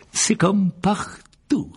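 aiguë, lente et mielleuse; voix doucereuse. Acted. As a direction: susurrer avec une fausse douceur. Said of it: "de sa voix doucereuse". (voix doucereuse de marchand flatteur)

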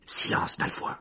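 sharp whisper; Harry, à voix basse. A narrator's reading. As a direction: shut him up under your breath. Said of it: "dit Harry à voix basse". (chuchotement impérieux)

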